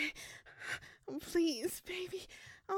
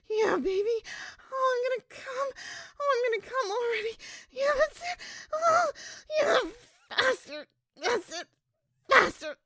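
higher and louder toward a shrieking climax; building ecstatic cries; 7 semitones higher, 11 dB louder than usual. Acted. Climaxing loudly with Carl urging him faster.

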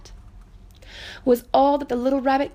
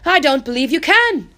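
louder, teasing wild rabbit; doubting wild rabbit; 4 semitones higher, 7 dB louder than usual. Acted. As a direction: dare him to prove it. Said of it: "he said".

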